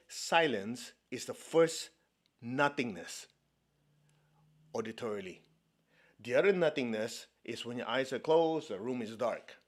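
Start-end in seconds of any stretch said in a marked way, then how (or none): 0.1-5.4 slow, spaced dictation pace; a measured repeat for note-taking. Shown as a demonstration. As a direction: spell it out slowly so they can write it down. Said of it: "Write it down. What did I say?".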